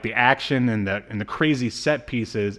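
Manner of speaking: normal talking voice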